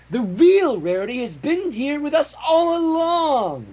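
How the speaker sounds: high, grand declaration; dramatic Cadance. Acted. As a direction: declare it like a stage reveal. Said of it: "declared Cadance dramatically".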